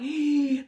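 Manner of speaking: hammed-up high intake of breath; gasp, lured by the sale signs; playacted thrilled gasp; excited gasp. Drawn like moth to flame.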